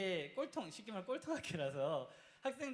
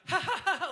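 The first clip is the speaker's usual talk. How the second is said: loud mocking ha-ha-ha laugh, higher; 12 semitones higher, 12 dB louder, slower than usual. Friend laughing at his failed exam, mocking laughter. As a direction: laugh right at him. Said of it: "웃으며 비웃는 거야".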